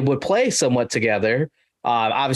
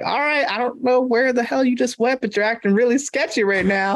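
put-on high, slurry apologetic drunk; drunk guy voice; 10 semitones higher than usual. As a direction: play a sloppy drunk caught trespassing, high. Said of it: "And he's like".

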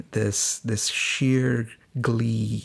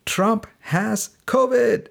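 high, slow, punched-out headline; all-caps announcement; 10 semitones higher, 4 dB louder, slower than usual. Retold or imitated. Daughter texting dad big news in all capitals, excited announcement. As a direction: shout out a texted exclamation, drawing out each word. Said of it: "in capitals, all capitals".

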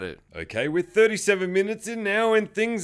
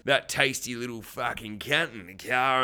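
aggressive villain; 5 semitones lower, 4 dB quieter than usual. Acted. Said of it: "they're real fucking aggressive".

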